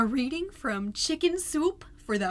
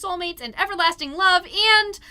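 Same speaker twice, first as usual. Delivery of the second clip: louder, higher and fast; building outburst; 5 semitones higher, 7 dB louder than usual. Acted. Mom reaching the end of the list, swelling loud. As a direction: let it swell to a peak.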